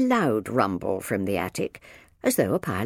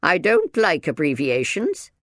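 louder, raised, disapproving minder; correcting; 5 semitones higher, 5 dB louder than usual. A narrator's reading. Minder objects to Sam's short name, disapproving. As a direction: lay down the rule firmly. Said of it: "the minder corrected him".

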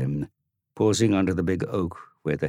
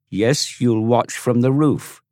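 high, louder, slow final word; killing the argument; 7 semitones higher, 6 dB louder, slower than usual. Acted. Tom to Dorian, high slow dismissive verdict. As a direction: end it dead. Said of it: "killed the argument dead".

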